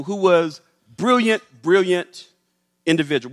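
ordinary talk

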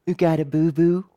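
a hint of a small child asking, curious; little child's voice. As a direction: toddler asking a stranger, lightly mimicked. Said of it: "I hear a little voice go".

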